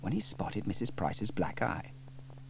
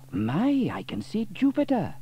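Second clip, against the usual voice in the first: amazed Sam; 10 semitones higher, 3 dB louder than usual. A narrator's reading.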